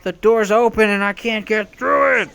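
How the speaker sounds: exasperated gamer whine; frustrated whine. Real, not acted. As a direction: whine at the stuck door. Stuck at door, frustrated.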